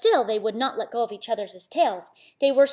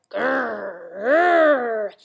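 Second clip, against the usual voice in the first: drawn-out imitated growl, getting louder; tiger growl; 7 dB louder than usual. A narrator's reading. Horrible noise heard by little Samba, swelling tiger growl. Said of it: "a horrible noise that sounded like".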